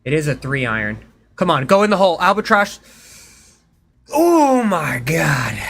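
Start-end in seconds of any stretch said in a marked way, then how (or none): 1.4-2.8 tense hopeful shout at the ball; urging the ball into the hole, loud and high; threading a needle. Real, not acted. Pleading for a precise long shot. 4.1-5.6 drawn-out amazed cry, slow and high; overwhelmed gasp of disbelief; stunned. Real, not acted. Reacting to a huge shot.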